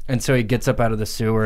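normal talking voice

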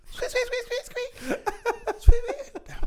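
high squeaking noise; squeaky rubber duck. Acted.

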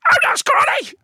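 very high, loud puppet squeak. Acted. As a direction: voice the scrawny puppet talking back. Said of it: "scrawny looking puppet".

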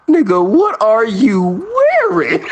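slow, rising disbelief on wearing; incredulous. Retold or imitated. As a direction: stretch it out in disbelief. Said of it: "I heard him go".

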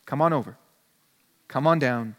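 gentle Jesus. Retold or imitated. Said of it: "And he says".

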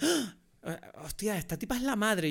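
sudden high gasp of discovery, imitating a friend; surprised gasp. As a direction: blurt out the twist like you just cracked it. Friend guessing film's twist aloud.

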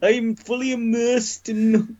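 very high mock Welsh accent; Eddie Marsan. Podcaster mocking an actor's accent, bad Welsh voice. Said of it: "your bad Welsh accent".

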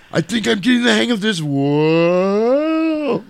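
high, quick line into a long whoa; hammed-up airborne exclamation; mock flying wobble. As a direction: imitate someone losing control in flight. Hero wobbling on jetpack, acted.

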